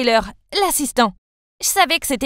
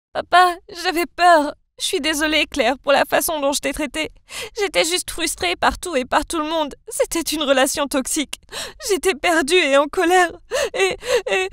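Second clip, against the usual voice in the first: tearful; 4 semitones higher than usual. Acted. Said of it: "Anna a commencé à pleurer".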